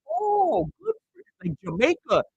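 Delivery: high-pitched condescending imitation; mock patronizing. Imitating a lady talking down to a Jamaican coworker, patronizing. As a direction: talk down sweetly, starting high then dropping.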